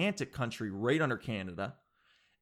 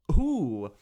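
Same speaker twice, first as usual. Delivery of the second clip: loud ooh at own pun; 8 dB louder than usual. Real, not acted. Reacting to his own pun.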